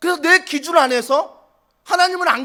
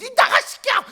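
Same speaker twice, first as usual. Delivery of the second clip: put-on shrill, much higher scolding yell at a child; mother's high, cracking yell at her kid; screaming mother; 11 semitones higher than usual. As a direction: screech it like a mother losing her temper. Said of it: "목소리 톤 높아가고 막 찢어지고".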